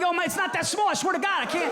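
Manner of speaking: high, rushed nervous pleading; squeaky nervous self-talk; frantic inner panic. Retold or imitated. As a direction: babble it out in a panic. Panicked inner monologue at doctor.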